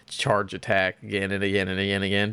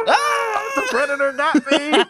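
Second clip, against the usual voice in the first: laughing mock cry; 4 dB louder, slower than usual. Pretend attack by predator.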